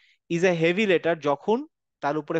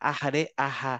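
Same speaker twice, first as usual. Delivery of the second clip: আহারে breathed out gently and softer, half whispered; soft airy aha; 3 semitones lower, 4 dB quieter, slower than usual. Shown as a demonstration. Teacher shows students the soft breathy আহারে. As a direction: breathe আহারে out softly, like soothing a hurt child. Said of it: "একটু ফিস ফিস করে বলি".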